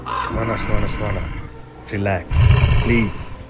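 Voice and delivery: loud, urgent reassurance; calm her down, loudly. Acted. Calming panicked friend urgently.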